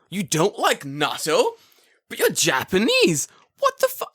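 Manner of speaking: high, loud, drawn-out outrage; mock disbelief. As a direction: stretch it out as if scandalised. Mock shock at natto answer.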